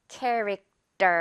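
slow 캐릭터 with a tense 떨 at the end; 캐릭터 slowly modelled, T as a tight 떨. Shown as a demonstration. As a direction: draw out 캐릭터, finishing on an unaspirated 떨. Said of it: "떨처럼 발음을 해줘야 된다는 거".

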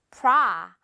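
프라 with a wide open ah vowel; 프라 said with a wide open mouth. Shown as a demonstration. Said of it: "이렇게 크게 벌려줘야 돼요".

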